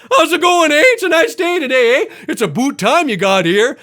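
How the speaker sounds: put-on loud, high caricature Canadian accent; dumb-sounding Canadian accent. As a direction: belt out a dim-witted cartoon Canadian accent. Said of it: "like we're really, really dumb".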